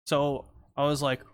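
ordinary talk, nothing marked

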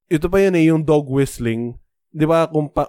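ordinary delivery

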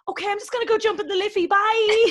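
high, fast, mock-cheery escape; mortified exit. Woman just told he has a girlfriend, mortified mock-cheery exit. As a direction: rush it out and build to a loud squealed bye. Fleeing after romantic rejection.

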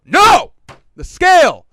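very loud, high exasperated yell; cranky shout. Retold or imitated. Cranky dieter correcting family member at checkout, furious retold shout. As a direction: shout it in two separate bursts. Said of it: "I'm still very cranky".

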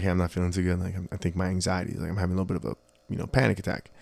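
quiet, anxious. Retold or imitated. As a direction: tell her quietly you're not okay. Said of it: "I was like".

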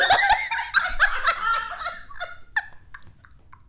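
long, very high laughing fit; helpless laughter. Real, not acted. Friends crack up over a name slip, high helpless laughter. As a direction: lose it completely, squealing with laughter.

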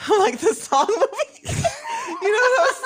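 high words broken by laughter; laughing high. Real, not acted. Laughing at the song movie name, high giggles.

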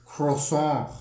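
"croissant" with a throaty r and no final t; mock-French "croissant"; French pronunciation. Shown as a demonstration. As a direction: try out a showy French "croissant". Tries out the French word.

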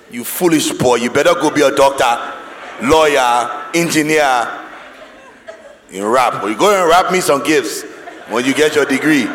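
mock scolding Nigerian parent accent. Parent to a child wanting a rap degree, Nigerian accent. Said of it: "That was in my Niger accent".

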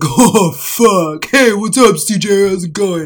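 loud mock bass-girl voice; booming caricature of a bass-voiced classmate; Zoe. Classmate greeting CJ in a bass voice, caricatured. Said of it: "She would literally talk like this".

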